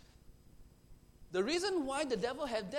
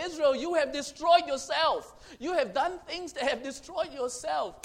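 louder and higher, a grieving call to a lost people; crying out from heaven; 5 semitones higher, 6 dB louder than usual. Acted. God calling down from heaven to Israel, loud grieving cry. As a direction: cry it out like a father mourning his child. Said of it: "God is crying from heaven and say".

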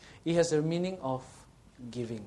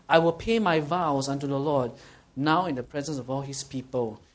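louder, emphatic verse; forceful scripture reading; 5 dB louder than usual. Acted. Preacher reads David's vow to the congregation, emphatic. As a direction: proclaim the vow.

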